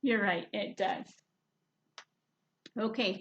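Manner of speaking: usual speaking voice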